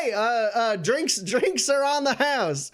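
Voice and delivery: keen to serve them; bartender. Acted. Bartender offering drinks.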